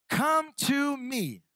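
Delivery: a slow, emphatic call to come; slow emphatic reading. Shown as a demonstration. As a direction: lean on each word slowly. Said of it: "Let's all say".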